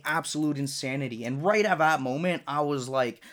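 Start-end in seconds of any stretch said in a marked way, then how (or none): none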